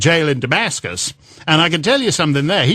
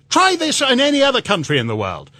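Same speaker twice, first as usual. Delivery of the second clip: ordinary talk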